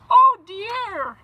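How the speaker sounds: high, loud anguished wail; crying out. Acted. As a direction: cry out in despair for his lost wife. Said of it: "he cried".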